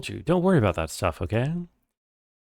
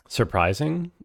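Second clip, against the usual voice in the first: usual speaking voice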